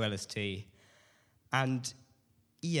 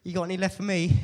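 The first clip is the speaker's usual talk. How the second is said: much higher, louder imitated plea; hopeful plea to God; 9 semitones higher, 7 dB louder than usual. Speaker asking God for leftover guidance, hopeful mock plea. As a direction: pipe up, mock-pleading for a share. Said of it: "there's just me going".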